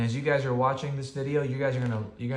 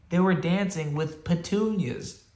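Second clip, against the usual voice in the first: plain reading voice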